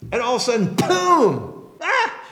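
a sudden high burst, then a startled cry; mock fright at a sudden flash; startled boom and gasp. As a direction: jolt awake with a big boom and a gasp. Startled disciples waking to glory.

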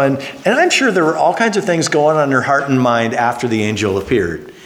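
ordinary talk

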